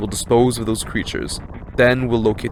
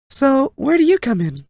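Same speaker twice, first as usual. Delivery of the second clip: much higher, light voice; high character voice; 14 semitones higher than usual. Acted. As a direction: pitch it way up for the figure.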